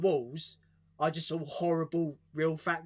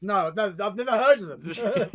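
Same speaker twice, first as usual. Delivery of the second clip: sarcastic mock denial; 4 semitones higher, 7 dB louder than usual.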